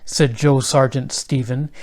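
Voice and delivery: plain reading voice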